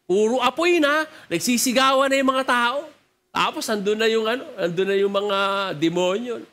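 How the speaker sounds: usual speaking voice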